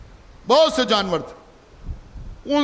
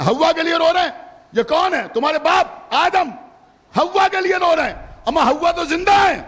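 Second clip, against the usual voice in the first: fervent preaching; 6 semitones higher than usual. Real, not acted. Preacher to the majlis gathering, fervent full-force preaching.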